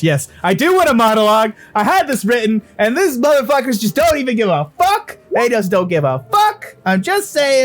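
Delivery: high, loud, hammed-up outrage; exasperated rant. Game master defending the monologue they had written, exasperated rant. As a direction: rant at the top of your voice, play-acting indignation.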